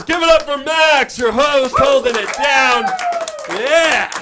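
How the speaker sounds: loud, high hype-man call; big announcer-style shout-out. Real, not acted. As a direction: pump the crowd up for the host. Hyping the host to crowd.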